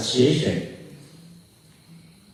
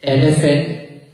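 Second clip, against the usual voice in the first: usual speaking voice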